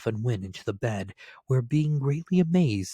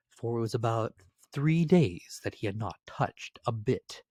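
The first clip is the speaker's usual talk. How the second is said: usual speaking voice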